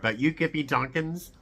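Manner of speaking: ordinary delivery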